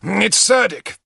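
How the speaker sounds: high eager announcement; bursting with news; excited. Acted. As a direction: Lancelot pointing out the Saxon king, excited. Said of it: "he added excitedly".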